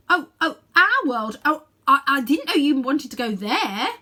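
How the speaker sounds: loud, flustered stammer. A narrator's reading. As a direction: stammer it out, red in the face.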